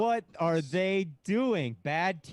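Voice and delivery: high, drawn-out disbelief; incredulous outcry. Real, not acted. Host aghast at the team's pick, high and incredulous. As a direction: wail it slowly, aghast. Shocked reaction to a bad pick.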